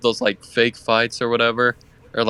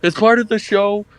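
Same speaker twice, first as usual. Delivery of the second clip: higher, pitched-up announcement; calling out to a crowd; 8 semitones higher, 3 dB louder than usual. Pretend. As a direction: reassure onlookers it's all staged. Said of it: "just be like".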